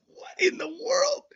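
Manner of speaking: high, whispery squeal of amazement; stunned disbelief. Real, not acted. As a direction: gasp it out, blown away. Stunned by the attack panel.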